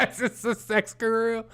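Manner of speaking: high, laughing question. Real, not acted. Reacting to the article's guru, laughing. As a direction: ask it through helpless giggles.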